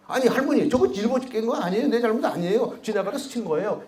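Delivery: a hint of a boy pleading his innocence; pleading child. Boy pleading with his grandmother, lightly voiced and high. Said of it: "하고 싶은 말이 있었습니다".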